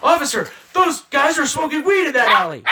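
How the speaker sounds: high, loud tattletale shout; mock calling out. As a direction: holler for an officer, hammed-up. Acts out calling to a policeman.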